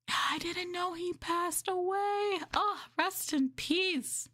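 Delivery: high, slow hammed-up dismay; mock shocked gasp. Streamer hamming up shock at a celebrity death. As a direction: gasp then mourn theatrically.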